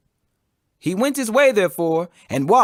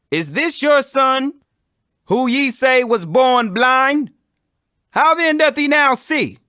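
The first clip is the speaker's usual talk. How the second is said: demanding Pharisees; 7 semitones higher, 5 dB louder, slower than usual. A narrator's reading. Pharisees interrogate the parents.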